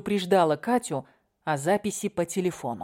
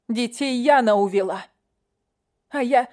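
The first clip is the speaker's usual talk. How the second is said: desperate; 5 semitones higher, 4 dB louder than usual. Acted. Leila desperate, louder.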